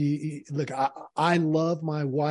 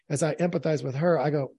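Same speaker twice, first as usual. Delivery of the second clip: normal talking voice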